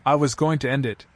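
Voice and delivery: usual speaking voice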